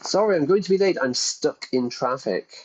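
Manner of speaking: lightly acted excuse to the boss; mock late excuse. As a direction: play at calling in late, voice lifted. Mock phone excuse for being late.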